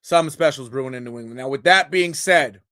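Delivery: normal talking voice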